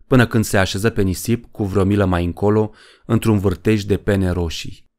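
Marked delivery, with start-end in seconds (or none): none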